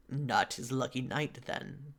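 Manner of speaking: slow, soft mild rattle; understated rattle, unhurried; rattling voice. A narrator's reading. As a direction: let it trail out slowly, softly rattled. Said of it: "Bouchard rattles".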